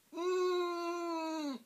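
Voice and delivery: high closed-mouth hum; clenched refusal hum. A narrator's reading. Boy refusing through clenched jaws, humming. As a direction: refuse with lips shut.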